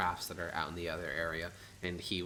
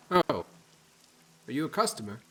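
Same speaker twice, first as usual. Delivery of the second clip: husband voice; 7 semitones higher, 9 dB louder than usual. Acted.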